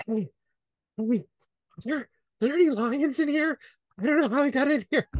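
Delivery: very high, put-on lost-in-the-cage voice; squeaky-high mock bewilderment; joking mock innocent. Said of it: "me being the super sarcastic, thinking I'm funny kind of guy".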